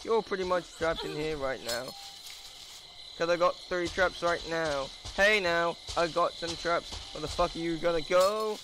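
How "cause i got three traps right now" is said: ordinary talk, nothing marked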